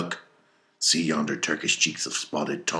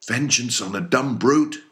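much higher, loud protesting cry; cried out; 9 semitones higher, 6 dB louder than usual. A narrator's reading. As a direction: hurl it back at the captain in alarm. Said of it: "cried Starbuck".

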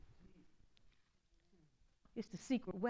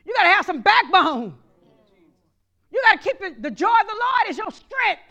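louder, higher preaching; preaching at full force; 7 semitones higher, 12 dB louder than usual. Acted. Pastor urging the congregation to hold on, loud. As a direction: drive it home to the church.

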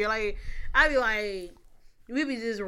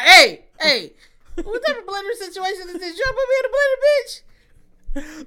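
high, loud mock indignation; mock outraged sister; 10 semitones higher, 10 dB louder than usual. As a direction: play up the scandalized sister, voice shooting high. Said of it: "I'm saying that to my sisters".

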